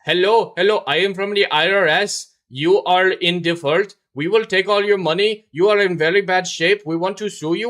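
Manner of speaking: put-on high, loud Indian accent phone scammer; Indian-accented scam caller, pushy and loud; mock Indian accent scam caller. Imitating an Indian accent scam caller threatening a victim on the phone. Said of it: "Indian accent dude calling who's like".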